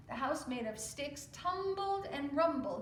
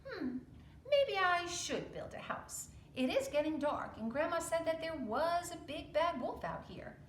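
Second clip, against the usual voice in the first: a small pig growing uneasy at dusk; worried littlest pig; 7 semitones higher than usual. Acted. Littlest pig deciding to build before dark, higher and uneasy. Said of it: "the littlest pig decided".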